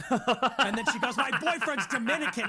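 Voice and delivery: laughing loudly through the retelling. Real, not acted. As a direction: laugh your way through the quote. Laughing while retelling quote.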